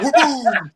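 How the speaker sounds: loud mouth-made boom, high then dropping; explosion noise. Retold or imitated. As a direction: blast it out like a bang. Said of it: "like an explosion".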